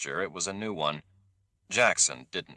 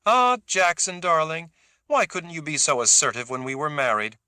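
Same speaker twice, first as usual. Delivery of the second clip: mocking; 10 semitones higher, 5 dB louder than usual. A narrator's reading. Kazi mocks her ex-husband.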